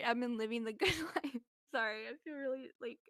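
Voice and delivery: giggling through tipsy words; tipsy laughing apology; real giggles. Real, not acted. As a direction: let the giggles break through. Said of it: "I'm really giggly".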